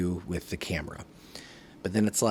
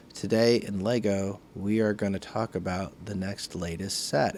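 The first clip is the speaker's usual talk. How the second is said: flat, level mock presenter read; monotone presenter; 2 semitones lower, 3 dB quieter than usual. Shown as a demonstration. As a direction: drone it like a dull host. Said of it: "monotone personality".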